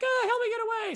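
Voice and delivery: high, pleading damsel; hammed-up damsel in distress. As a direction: beg in a mock high damsel voice. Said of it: "She's like".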